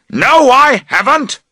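high, loud shouted denial; shouting. A narrator's reading. Friend shouting back over the tent, loud denial. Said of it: "he shouts".

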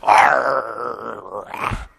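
long frustrated growl; drawn-out mock growl of a fuming kid; little boy growl. Calvin runs in circles with clenched fists, frustrated growl. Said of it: "making those little boy sounds".

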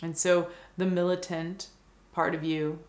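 calm meditation guide, long pauses between phrases; guided meditation. Acted. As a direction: guide the listener gently into stillness, unhurried and even.

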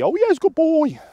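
put-on very high, squealed baby-talk praise. Shown as a demonstration. Trainer shows viewers the vocal inflection reward, baby voice. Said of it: "That baby".

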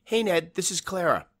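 high, tense phone greeting; anxious, trying to sound normal; nervous. A narrator's reading. Frightened woman on the phone.

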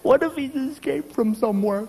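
high, fretful wife voice; panicky wife. Retold or imitated. Wife fretting to husband about an intruder, high and panicky. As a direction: fret it out high, clinging to him.

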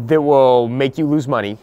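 usual speaking voice

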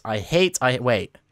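ordinary delivery

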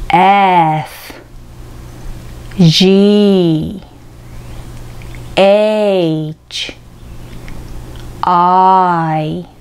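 slow, clear letters for the class to repeat, with pauses between; 復唱用のアルファベット; alphabet drill. Shown as a demonstration. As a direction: model each letter for repeating. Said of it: "最初から一通り言ってみましょう".

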